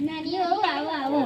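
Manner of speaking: hushed, low-key welcome; soft welcome. Acted. Greeting the younger daughter-in-law softly.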